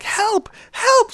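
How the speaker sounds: high, loud cry for help; mock screaming for help. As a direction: call out for help in panic. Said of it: "Screaming for help".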